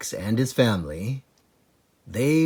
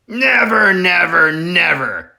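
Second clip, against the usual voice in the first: higher, louder repeated never, falling away; crying out; 7 semitones higher, 6 dB louder than usual. Acted. Boggis vowing with the others, crying out. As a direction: yell never three times, fading. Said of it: "cried Boggis".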